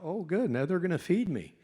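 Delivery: mock his own naive delight at being fed; eager inner thought. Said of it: "I thought".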